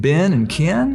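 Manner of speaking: BEN and KEN said as "bin" and "kin"; accent pronunciation. Retold or imitated. Wife teasing him about his accent, "Ben" and "Ken" as "bin" and "kin". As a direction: say "Ben" and "Ken" with short-i vowels, drawn out. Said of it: "You got a Southern accent".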